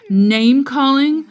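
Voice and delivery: building outrage. A narrator's reading. Building list of bad manners.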